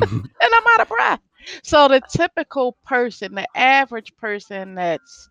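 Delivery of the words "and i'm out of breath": laughing. Real, not acted. Laughing while telling it.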